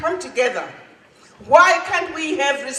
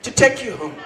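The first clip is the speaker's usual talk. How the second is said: ordinary delivery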